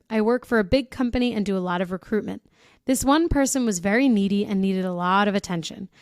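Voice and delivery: normal talking voice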